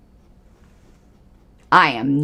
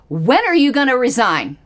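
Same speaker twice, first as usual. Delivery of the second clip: higher and louder, a pointed challenge; demanding; 6 semitones higher, 4 dB louder than usual. Real, not acted. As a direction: call her out directly and loudly. Demanding Erin resign.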